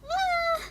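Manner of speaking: very high squeal; sudden excited shriek; fangirl squeal. Real, not acted. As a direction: squeal with joy. Sees favourite photo.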